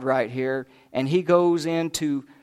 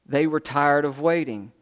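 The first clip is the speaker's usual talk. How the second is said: usual speaking voice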